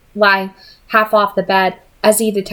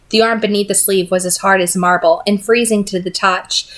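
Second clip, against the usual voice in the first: ordinary delivery, nothing marked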